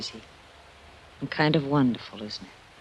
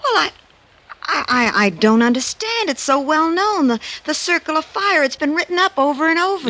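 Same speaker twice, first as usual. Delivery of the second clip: much higher and louder, stammering protest; can't make sense of it; rattled disbelief; flustered; 13 semitones higher, 5 dB louder than usual. Acted. Flustered over the fake necklace.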